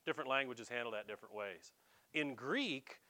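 ordinary talk

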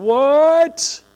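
high, loud indignant protest; mock indignant crowd shouting back; outraged crowd. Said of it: "People are like".

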